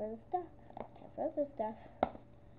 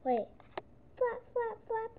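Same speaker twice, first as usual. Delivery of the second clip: ordinary delivery, nothing marked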